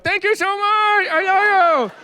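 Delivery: very high, slow, strained gratitude; jaw-wired gushing thanks. Retold or imitated. Grateful man thanking his partner, high squeezed gushing. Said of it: "come and be like".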